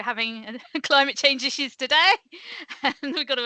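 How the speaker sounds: amused, laughing through the words. Real, not acted. Speaker to webinar audience about today's climate issues, laughing. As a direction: talk through a real chuckle.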